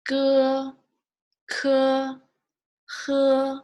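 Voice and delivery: teacher leading a pinyin drill; Mandarin initials drill. Shown as a demonstration. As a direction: call out Bo, po, mo, fo crisply for repetition. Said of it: "we'll say it one more time from here".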